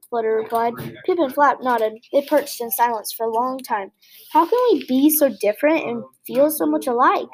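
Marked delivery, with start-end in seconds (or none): none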